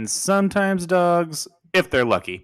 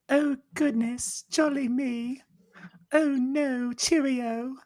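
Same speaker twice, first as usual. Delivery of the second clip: a light mock British lady; British accent; 6 semitones higher than usual. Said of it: "I'm going to imitate her".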